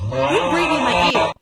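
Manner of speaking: loud shout of disgust at a creepy sound; loud creeped-out cry. Real, not acted. Creeped out by the game voice.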